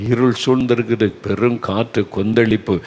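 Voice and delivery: ordinary delivery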